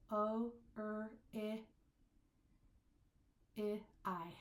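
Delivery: "O, er, I, I, I" said one by one; isolated vowel sounds. Shown as a demonstration. Teacher counting five vowel sounds, isolated and spaced. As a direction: separate each vowel with a clear gap. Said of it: "Let's count our vowel sounds".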